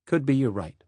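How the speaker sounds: usual speaking voice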